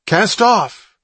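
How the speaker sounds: high, loud shouted order. A narrator's reading. Boy at the motor ordering his brother, shouting. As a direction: call it out over the engine. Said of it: "he shouted".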